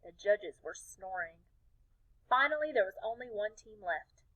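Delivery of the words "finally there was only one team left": ordinary delivery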